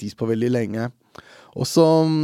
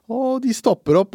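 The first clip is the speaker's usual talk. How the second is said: ordinary talk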